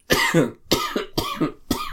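high-pitched choking cough; real drawn-out bout of hacking; coughing fit. Real, not acted. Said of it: "without coughing again".

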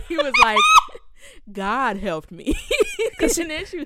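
can barely get the line out for laughing; laughing through it. Real, not acted. Friend retelling a TikTok to her cohost, laughing hard. Laughing while telling the story.

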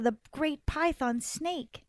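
ordinary delivery, nothing marked

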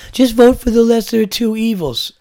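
mocking quote. Mocking a common voter saying.

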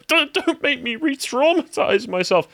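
very high, slow pleading; mock victim. Client begging the lawyer, in a high, slow mock plea. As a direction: plead slowly in an exaggerated high voice. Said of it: "And they go".

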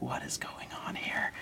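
breathy whisper, barely audible; puzzled whisper to yourself; whispered disbelief. Retold or imitated. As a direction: mutter it under your breath in confusion. Puzzled at man picking pennies.

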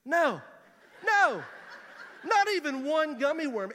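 loud, high-pitched imitation of a refusing child; emphatic refusal. Seven-year-old daughter refusing dad candy, emphatic refusal.

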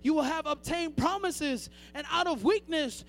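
usual speaking voice

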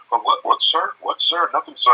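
high-pitched, flustered imitation of a nervous boyfriend; mock a nervous kid answering his girlfriend's dad; scared boyfriend. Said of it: "he's still scared of me".

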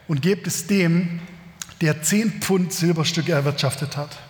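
calm, measured reading of a Bible passage; scripture reading. Acted. Pastor reading the parable to his congregation, measured reading voice. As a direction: read the passage to the congregation, unhurried.

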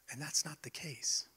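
soft whispered aside. Real, not acted. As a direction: drop to a whisper. Pastor's quiet aside.

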